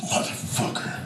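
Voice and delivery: soft, voiceless swearing; whispered curse. Retold or imitated. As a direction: voice a resentful look under the breath. Child's look voiced as muttered curse.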